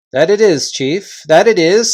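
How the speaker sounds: agreeing chorus. Acted. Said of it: "said the others".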